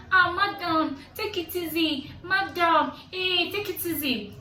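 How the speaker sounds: high pleading voice imitating the wife; a wife begging madam to calm down. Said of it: "was like".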